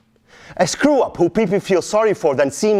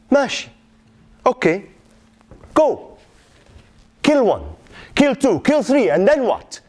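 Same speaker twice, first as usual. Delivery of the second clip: high mocking count; mock his plan, daring him; bitter sarcasm to son; sarcastic challenge; 5 semitones higher than usual. Father mocks son's plan.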